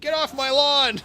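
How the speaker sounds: high, loud cranky shout; angry old man yell. Acted. Yelling a cartoon quote at friends, high and loud. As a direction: bellow it like a grumpy neighbour.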